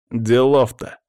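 lightly self-satisfied, with a smirk; smug smirk. A narrator's reading. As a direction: toss it off with mild smugness. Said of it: "довольно ухмыльнулся Павел".

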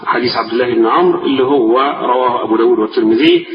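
ordinary delivery

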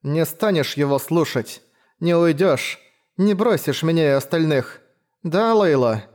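pleading from under a blanket; muffled choppy voice. Acted. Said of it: "глухо и отрывисто".

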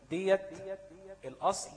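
ordinary delivery

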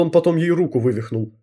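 ordinary delivery

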